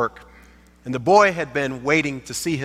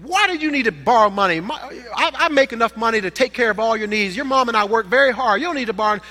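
angry father; 6 semitones higher, faster than usual. Pretend. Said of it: "the father got angry".

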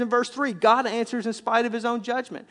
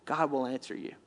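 ordinary talk, nothing marked

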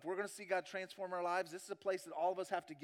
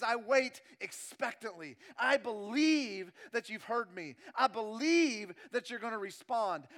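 loud, raised, impassioned preacher's voice; full-force preaching; 4 semitones higher, 8 dB louder than usual. Acted. Preacher voicing David waiting on God, loud impassioned preaching. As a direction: build it with urgent conviction.

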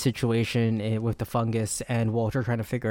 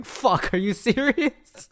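mock disbelief, higher and slow; a hammed-up stunned Olivia; imitating Olivia's disbelief; 10 semitones higher, much slower than usual. Retelling Olivia reacting to her boss, in mock disbelief. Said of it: "Olivia going".

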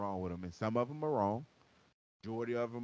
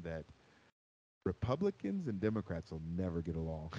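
lower, put-on radio announcer voice; 6 semitones lower than usual. Shown as a demonstration. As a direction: put on your smooth, low "radio voice". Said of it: "that's my radio voice".

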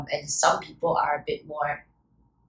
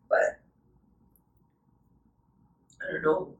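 choked up, voice fading to near silence; real tears breaking through the words; crying; 3 semitones higher than usual. Real, not acted. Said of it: "Sorry, I'm crying".

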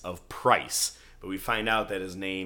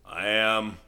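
Mike impression; 2 semitones higher, 8 dB louder than usual. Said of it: "I'll do his voice all day".

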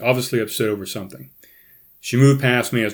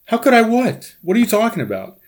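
much higher, playing dumb; feigned surprise; 12 semitones higher, 4 dB louder than usual. Husband playing dumb to his crying wife, feigned surprise. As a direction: act baffled, pitch way up.

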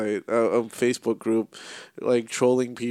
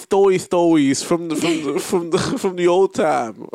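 high, slurred baby-talk imitation; mispronouncing impression; 5 semitones higher, 4 dB louder, slower than usual. Friend quoting her from an old podcast, mocking baby talk. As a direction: drag out the last words in a mock speech impediment. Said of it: "from a previous podcast".

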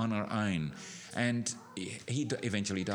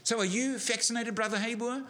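higher, raised question called across; retold call-out; 10 semitones higher than usual. Man in the church calling out his question to the preacher. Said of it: "one guy yelled out to me".

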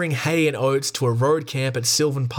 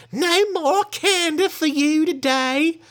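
high, slow falsetto command; lady ordering; slower than usual. Acted. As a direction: shoo the bear away like a prim lady. The lady scolds the bear.

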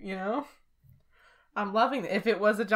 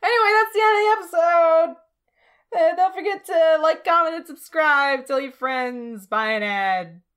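much higher, much louder sign-off; hyped outro announcer; 8 semitones higher, 8 dB louder than usual. Acted. Podcast host signing off to listeners, hyped announcer. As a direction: pitch it like a YouTuber's big ending.

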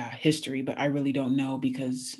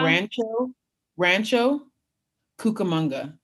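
slow, word by word, pauses between; slow demonstration; 5 semitones higher, 5 dB louder, slower than usual. Shown as a demonstration. Repeating her hometown's name slowly for the interviewer, slow demonstration. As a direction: say "Rancho", then "Cucamonga", spaced out clearly. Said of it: "one more time slowly for me".

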